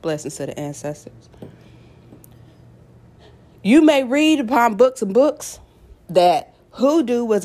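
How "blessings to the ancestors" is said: quiet words right after a sip of coffee; while sipping a drink. Real, not acted. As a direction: speak softly around a sip of coffee. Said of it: "I'm gonna take a sip of my coffee".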